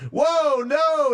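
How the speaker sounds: high startled whoa; alarmed whoa. Acted. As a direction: jump in, alarmed. Part interrupts shouting.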